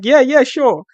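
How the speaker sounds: high, loud, brushing-off agreement; imitating Dana. Boss answering a reporter, high loud mock agreement. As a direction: blurt an over-eager mock assent. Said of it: "Dana was like".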